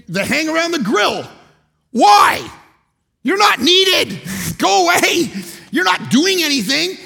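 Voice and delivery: high, loud, slow shout of go away; mock yell at the grill guys. Pastor mock-yelling at men hovering by the grill. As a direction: shoo them off the grill, hammed up.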